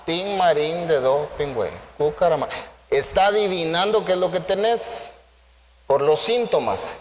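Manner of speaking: ordinary delivery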